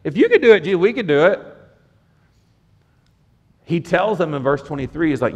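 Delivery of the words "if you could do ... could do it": arrogant boast. Retold or imitated. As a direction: brag like overconfident disciples. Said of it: "And in their arrogance".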